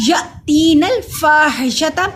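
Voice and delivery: slow recitation, या stopped with a glottal catch; या तीन अल फाहिशता with long tee and faa; jerk on hamza. Shown as a demonstration. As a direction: jolt on या, then measured long vowels. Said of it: "हम इस पे झटका देंगे".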